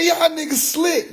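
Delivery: high, slow knowing tease; teasing. Retold or imitated. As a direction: call them out playfully. Retold teasing remark.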